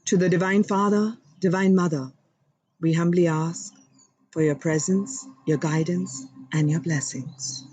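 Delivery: humble prayer. Acted. Host invoking God and guides for viewers, reverent prayer voice. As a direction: pray humbly and sincerely. Opening prayer to divine beings.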